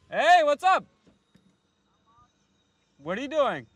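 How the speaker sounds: high, loud call from the car. Real, not acted. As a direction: hail him across the lot. Calling out to arriving dad.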